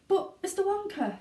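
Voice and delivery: high halting plea; stammered. Acted. Grandpa Joe stammering at Wonka, high and halting. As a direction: stumble over it in disbelief. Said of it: "stammered Grandpa Joe".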